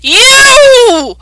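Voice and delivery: high, loud furious yell; angry guard shout. Acted. The guard shouts at the druid over the cat. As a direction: shout him down as the law. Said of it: "and is like".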